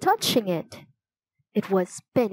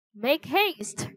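urgent, higher-pitched call at usual volume; mild yell; 6 semitones higher than usual. A narrator's reading. Teddy rushes friends into a room, contained yell. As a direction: hurry them along in a raised pitch. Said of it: "he yelled".

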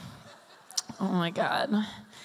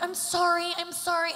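much higher, gushing sorry; over-empathetic apology; 9 semitones higher than usual. Retold or imitated. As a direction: coo the apology way up high. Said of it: "I'm like".

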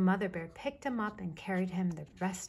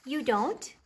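higher, louder, rising disbelief; surprised; 6 semitones higher, 6 dB louder than usual. A narrator's reading. Baby Bear learns Mama doesn't know everything, surprised. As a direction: ask it like a startled little cub. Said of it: "sounding surprised".

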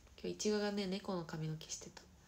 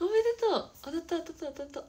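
high, loud, gleeful cheer; real high-pitched excitement; excited congratulations; 9 semitones higher, 13 dB louder than usual. Real, not acted. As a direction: celebrate loudly between bursts of laughter. Celebrating a hit, between laughs.

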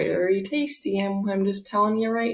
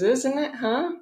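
higher-pitched, playful check; playful reply; 5 semitones higher than usual. Retold or imitated. Jesus answers her about the smoothie shop, playful. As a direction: lightly act out a pleased, higher "huh?".

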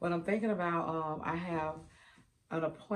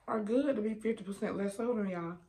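chewing while talking; talking through crunchy food; mouth full; 4 semitones higher than usual. Real, not acted. Seen 2: Puts chip in mouth at start; cheeks puffed chewing while talking.

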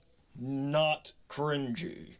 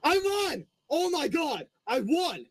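excited shouting; 9 semitones higher than usual. Real, not acted. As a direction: shriek with joy at winning. Thrilled win in game.

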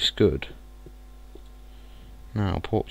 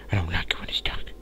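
fast, fearful whisper; scared whisper; 2 semitones lower, 4 dB quieter, much faster than usual. Real, not acted. Gamer alone in the dark, scared fast whisper. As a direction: whisper nervously about the dark.

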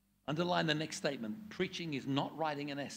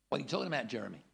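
usual speaking voice

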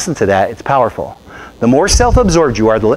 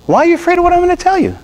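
much higher, fast exasperated question; incredulous question; faster than usual. Retold or imitated. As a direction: fire it off high and quick in disbelief. Retold pastor confronting scared lady.

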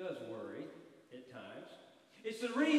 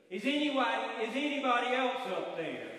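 loud desperate yell; 6 semitones higher, 7 dB louder than usual. Acted. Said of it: "yells as loudly as he could".

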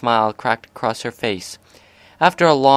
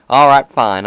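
giving in; 3 semitones higher, 9 dB louder than usual. A narrator's reading. Giving in loudly.

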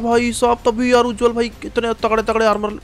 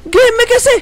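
high, loud alarmed yells; screaming in panic at the surprise arrival; panicked shouting; 11 semitones higher, 5 dB louder than usual. Real, not acted. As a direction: shout in shock as the enemy joins. Sudden enemy arrival, panic.

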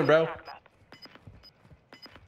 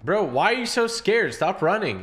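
mocking taunt; 7 semitones higher than usual. Taunting a fleeing enemy player.

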